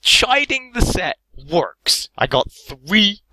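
high, loud, slow triumphant cry; excited cheer. Real, not acted. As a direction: crow over the lucky pack. Cheering his lucky pulls.

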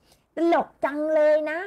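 mock-grumpy, stretched out high; sour, annoyed tone. Shown as a demonstration. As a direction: say it in a bad mood, slow and pointed. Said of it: "ต้องพูดด้วยเสียงอารมณ์เสีย".

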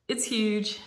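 usual speaking voice

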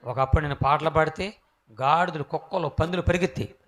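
usual speaking voice

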